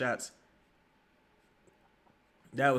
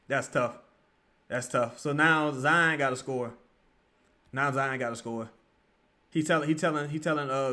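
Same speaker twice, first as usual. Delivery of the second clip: ordinary talk, nothing marked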